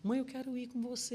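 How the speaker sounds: imitando a filha, bem mais aguda; voz de criança. Retold or imitated. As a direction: pedido manhoso de menina. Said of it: "ela fala assim". (filha pedindo pra ir ao show, voz de criança)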